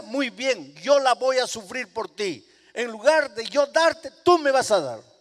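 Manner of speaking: high, loud, impassioned sermon cry; fiery pulpit proclamation; full-force preaching. Acted. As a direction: drive the point home to the congregation. Preacher climaxes mother's words.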